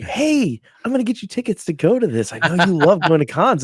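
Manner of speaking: playfully imitating his wife; mimicking wife. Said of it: "my wife's like".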